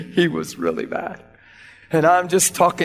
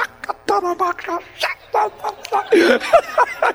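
speaking in tongues; 13 semitones higher than usual. Shown as a demonstration. Preacher shows the tongues he threw in, high syllables ending in laughter. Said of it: "couple little other tongues things".

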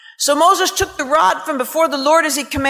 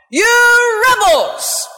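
very slow, higher, louder outburst of rage; furious shout; 8 semitones higher, 5 dB louder, much slower than usual. Acted. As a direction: roar "You rebels!" at them. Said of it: "He was furious".

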